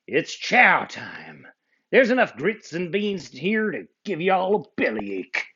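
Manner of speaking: high, hearty cowboy twang; cowboy camp leader. Acted. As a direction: round up the buckaroos cheerfully. Cowboy Dan speaking.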